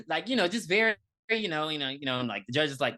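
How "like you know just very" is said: normal talking voice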